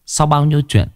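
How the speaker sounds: ordinary delivery, nothing marked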